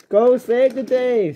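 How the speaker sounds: high, drawn-out cheer; high-pitched acted holler; shouted. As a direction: send her off with a slow rallying shout. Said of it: "shouted Harvey".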